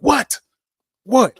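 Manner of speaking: sudden high loud double what, baffled; startled what. Retold or imitated. Hearing a voice repeat a name, startled high loud what. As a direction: shout it out, thrown by the strange voice.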